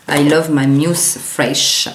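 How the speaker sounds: slow English in a strong French accent. Real, not acted. Vlogger reads out the wipe's English name, French-accented English. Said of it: "Avec mon super accent".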